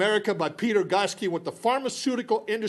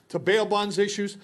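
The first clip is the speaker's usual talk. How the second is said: ordinary talk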